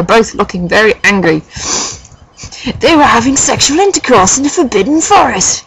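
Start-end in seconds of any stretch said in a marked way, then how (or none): 2.8-5.6 high, hammed-up furious yell. A narrator's reading. Dumbledore reporting the couple to the professors, high mock-furious yell. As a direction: swoop up in theatrical outrage. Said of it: "he yelled in a furious voice".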